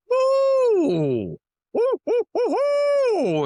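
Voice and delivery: rapid high owl whoops, hammered out; hammering owl calls. Shown as a demonstration. Calling back at a rival owl, rapid high hoots. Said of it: "just start hammering back at 'em".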